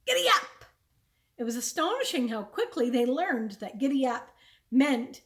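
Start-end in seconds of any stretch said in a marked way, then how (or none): 0.1-0.7 high, loud burst to start the calves; urging the oxen forward loudly; shouted command. A narrator's reading. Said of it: "he shouted".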